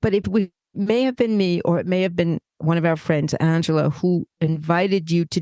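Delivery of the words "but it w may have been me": ordinary delivery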